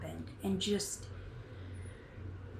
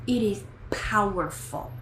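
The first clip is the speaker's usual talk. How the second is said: normal talking voice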